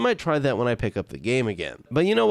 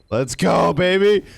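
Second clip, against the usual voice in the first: loud celebratory cheer; hyped cheer; 4 semitones higher, 6 dB louder, slower than usual. Real, not acted. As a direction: cheer it out, pumped. Celebrating in game.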